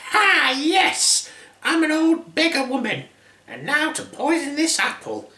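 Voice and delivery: high cackling witch voice; hammed-up high old crone; old hag. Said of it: "turned into an old raggedy hag".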